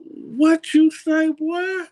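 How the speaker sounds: put-on high, slow, drawn-out "what you say, boy?"; a sudden high, slow challenge, half amazed; startled retort. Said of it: "I said".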